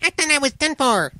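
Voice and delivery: small, very high voice; crackly fire man. A narrator's reading. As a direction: exclaim as a rescued little flame. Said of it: "crackly voice crying".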